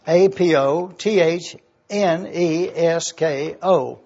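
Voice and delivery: A P O T H N E S K O spelled out slowly, one letter at a time. Shown as a demonstration. Preacher spells A P O T H N E S K O for the congregation, slowly. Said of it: "it is the word apothenesko".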